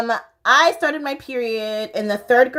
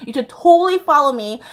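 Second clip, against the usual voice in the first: normal talking voice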